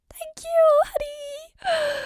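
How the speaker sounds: high delighted squeal of thanks; excited squeal. Acted. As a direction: burst out with giddy joy. Thrilled at being allowed look.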